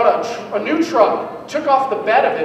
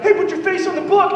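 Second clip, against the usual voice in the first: jock; 8 semitones higher than usual. Retold or imitated. Jocks mocking him.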